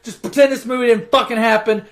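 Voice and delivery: ordinary talk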